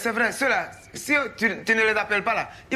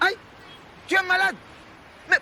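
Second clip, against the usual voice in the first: shocked; 9 semitones higher than usual. Acted. Man hearing on the phone that his friend is ill, shocked. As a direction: react as if hit by bad news.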